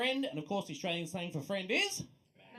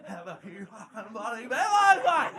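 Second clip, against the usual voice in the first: slow, louder, broad ocker drawl; 7 dB louder, slower than usual. Acted. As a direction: stretch it out like a boozy bloke at the pub. Bigger ocker repeat of line.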